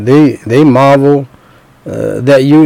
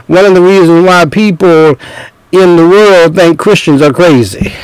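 raised-pitch, fervent sermon delivery; impassioned preaching; 4 semitones higher than usual. Acted. Preacher glorying in hardship before the congregation, impassioned preaching. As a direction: preach it with rising urgency, acting out the exchange. Preacher acting out challenge to Paul, building.

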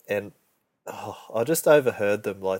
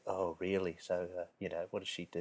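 much softer, secretive prying murmur; hushed gossip imitation; 4 semitones lower, 17 dB quieter, faster than usual. Mimicking the nosy coworker asking about the sister, hushed. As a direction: whisper it like office gossip. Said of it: "the other one was like".

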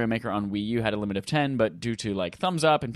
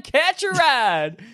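silly catchphrase voice again; 12 semitones higher, much slower than usual. Acted. Host repeating the catchphrase, slightly gentler. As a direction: echo the call in the goofy voice. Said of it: "this is not my real accent".

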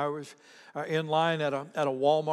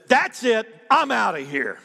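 loud, higher retelling of an exasperated customer; fed-up outburst; 5 semitones higher, 7 dB louder than usual. Retold or imitated. Woman at the checkout gives up, loud and exasperated. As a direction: blurt it out like a fed-up shopper storming off. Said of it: "getting louder and louder".

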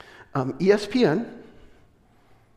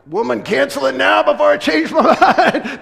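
much higher and louder, laughing through it; a hammed-up joking command to the wife; mock bossy order; 10 semitones higher, 9 dB louder than usual. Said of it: "I'm like".

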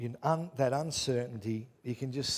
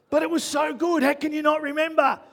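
much higher, louder mock whine; whiny exaggerated self; 10 dB louder than usual. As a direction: whine it like a petulant child.

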